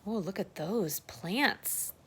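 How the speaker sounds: ordinary delivery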